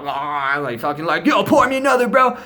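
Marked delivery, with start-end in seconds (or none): 1.2-2.4 high, loud party holler; exaggerated rowdy request; mock eager shout. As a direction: call out for a refill, hammed up. Acting out asking for more.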